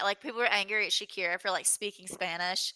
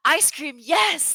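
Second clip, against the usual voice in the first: higher, louder gleeful yelp of delight; lightly voiced excited guest, higher and louder; 8 semitones higher, 8 dB louder, much slower than usual. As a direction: imitate his thrilled reaction to the treat. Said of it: "he got so excited".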